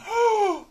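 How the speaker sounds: sharp high gasp of shock; stunned gasp. Pretend. Stunned by confirmation.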